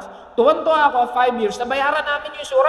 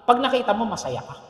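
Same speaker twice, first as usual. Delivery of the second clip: ordinary talk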